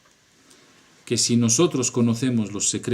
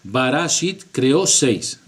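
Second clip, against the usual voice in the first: Barashit creó seis at slow pace, stress on creó; slow emphatic; 3 dB louder, slower than usual. Shown as a demonstration. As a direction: spell out Barashit creó seis slowly, leaning on creó. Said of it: "hay que leerlo en dos palabras".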